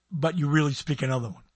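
normal talking voice

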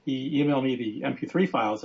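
ordinary talk, nothing marked

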